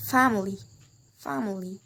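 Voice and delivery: "family" stressed on "fam-"; first-syllable stress. Shown as a demonstration. Teacher gives an -ly example, stressing "fam-" in "family". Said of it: "put in the first syllable".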